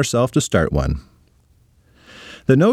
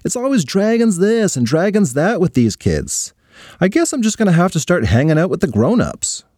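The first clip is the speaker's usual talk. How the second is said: complaining; 7 semitones higher than usual. Acted. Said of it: "she complained".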